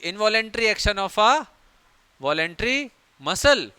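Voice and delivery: words stretched very slowly; slow teacher dictation. Shown as a demonstration. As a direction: dictate it so students can write. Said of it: "dictated definition".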